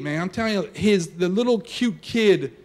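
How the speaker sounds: ordinary talk, nothing marked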